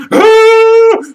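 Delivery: very high, loud mock shriek; imitated scream. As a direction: scream in hammed-up shock. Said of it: "that's why he was screaming".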